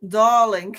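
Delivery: loud mock-British DARLING; a put-on British "darling"; British accent. Host playing British for her viewers, mock-British accent. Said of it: "You want to hear my British accent".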